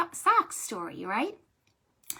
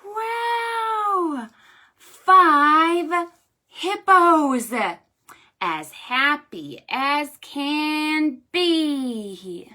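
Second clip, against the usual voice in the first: very slow, loud, high wow; delighted finale; 7 semitones higher, 8 dB louder, much slower than usual. Shown as a demonstration. Librarian ending the hippo rhyme with delight. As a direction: let the joy ring out slowly.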